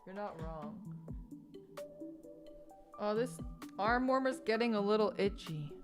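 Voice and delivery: usual speaking voice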